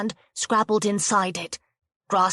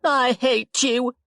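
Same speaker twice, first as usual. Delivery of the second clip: tearful outburst; 5 semitones higher, 3 dB louder, slower than usual. Acted. Rianne screams at Jo.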